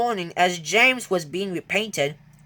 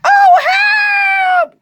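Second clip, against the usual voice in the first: very high, loud panicked shout; high, loud frightened cry; cry for help; 11 dB louder than usual. Acted. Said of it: "cried James".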